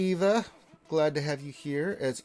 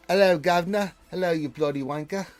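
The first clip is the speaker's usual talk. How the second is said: higher, louder mock British greeting; mock British accent; 6 semitones higher, 7 dB louder than usual. Streamer greeting a chat regular in jest, mock British accent. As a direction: put on a playful British accent.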